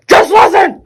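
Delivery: high, very loud command; insistent yell. Real, not acted. Streamer to viewers who can't see, insistent yell. Urging viewers, repeats it louder.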